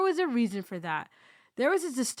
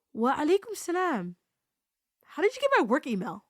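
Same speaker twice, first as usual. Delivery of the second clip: higher, stiffly displeased retold reply; upset; 6 semitones higher, 3 dB louder than usual. Woman answering an unexpected work email, upset. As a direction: demand an explanation, put out. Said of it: "but she sounded upset".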